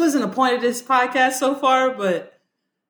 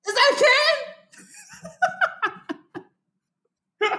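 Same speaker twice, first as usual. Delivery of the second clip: high startled recognition. Retold or imitated. Friend spots him thirty years later, high and startled. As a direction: gasp out a name you never expected. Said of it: "I'm like".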